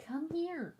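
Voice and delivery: high, soft pet call; coaxing the dog. Real, not acted. Streamer still luring her reluctant dog, high and coaxing.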